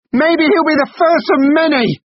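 very high, loud defiant yell. Acted. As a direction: scream it in his face. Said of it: "yelled Samantha".

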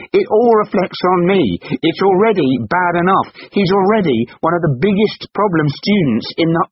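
loud, very high ranting; strained, full-voiced tirade; outraged shout. A narrator's reading. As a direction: rail at your wife in outrage. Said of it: "shouted Colin, outraged".

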